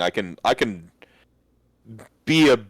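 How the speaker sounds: normal talking voice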